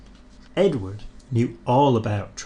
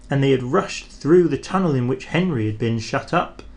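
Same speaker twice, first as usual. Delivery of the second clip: usual speaking voice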